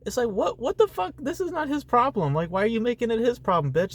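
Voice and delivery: high, incredulous rant; outraged disbelief. Real, not acted. Ranting about film character.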